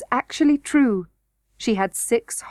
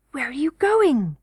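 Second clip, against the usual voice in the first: raised, falling question; startled cry; 5 semitones higher than usual. A narrator's reading. Curdie sees Irene disappear into the hole, calling out. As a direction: call after her, mildly alarmed. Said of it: "he cried".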